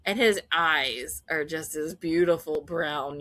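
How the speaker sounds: ordinary talk, nothing marked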